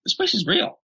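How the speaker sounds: high, amazed retelling of a friend's line; amazed remark. Retold or imitated. Friend on the gym tour, surprised by the place, high and amazed. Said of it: "she goes".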